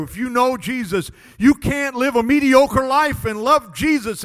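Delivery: loud, high, impassioned preacher; full-force preaching. Real, not acted. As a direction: drive it home to the congregation, stretching key words.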